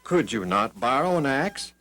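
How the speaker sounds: ordinary delivery, nothing marked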